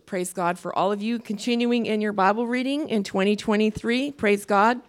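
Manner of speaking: ordinary talk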